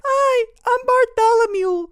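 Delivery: put-on very high, loud squeaky kid voice; Bartholomew as squeaker. Schoolboy introduces himself to Alex, in a squeaker kid voice. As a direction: squeak it out slowly like a little boy. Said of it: "he sounded like a squeaker".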